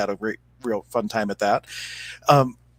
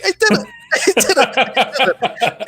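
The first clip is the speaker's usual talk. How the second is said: much higher and louder, laughing with glee; triumphant hummingbird; 5 dB louder than usual. Retold or imitated. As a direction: burst out like a tiny bird that just won. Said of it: "out pops the hummingbird going".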